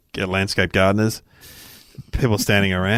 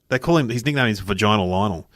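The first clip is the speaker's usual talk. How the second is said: usual speaking voice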